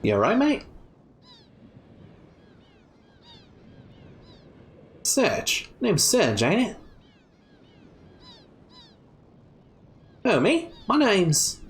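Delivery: lightly put-on Australian accent, cheeky girl; a breezy Aussie drawl for a tough young girl. Kid voiced with Australian accent.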